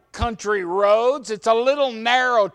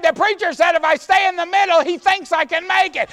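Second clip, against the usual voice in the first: fast, loud, higher insistent retort; loud arguing back; 8 semitones higher, 4 dB louder, faster than usual. Retold or imitated. Husband shouting back at his wife that he can make it, fast loud insistence.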